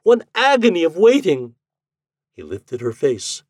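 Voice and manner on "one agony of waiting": high, loud vow; anguished cry. A narrator's reading. Lady vowing to the ghost, high and anguished. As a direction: swear it, voice lifted high in anguish.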